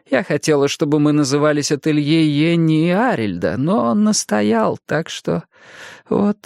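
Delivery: высокий голос пожилой женщины; мягкая разговорчивая старушка. A narrator's reading. Narrator voices old Jenny. (голос старушки)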